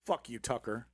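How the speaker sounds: ordinary talk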